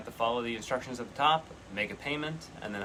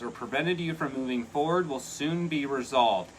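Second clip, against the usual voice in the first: card reading; 3 semitones higher, 4 dB louder than usual. Acted. Reads card text aloud.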